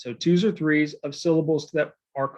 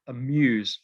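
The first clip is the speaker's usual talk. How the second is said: "amuse" with a light first syllable and weight on MUSE; stress on second syllable; 3 semitones lower than usual. Shown as a demonstration. Teacher showing an iamb, "amuse" stressed on its second syllable. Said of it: "an unstressed followed by a stressed".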